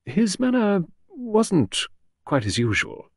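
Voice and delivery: slow, high, hesitant secretary; halting, drawn-out reply; said slowly. A narrator's reading. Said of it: "She said slowly".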